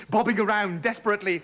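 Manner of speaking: ordinary delivery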